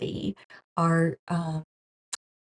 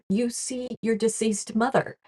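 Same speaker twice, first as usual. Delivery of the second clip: normal talking voice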